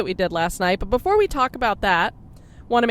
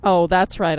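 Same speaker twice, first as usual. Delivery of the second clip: normal talking voice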